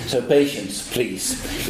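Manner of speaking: usual speaking voice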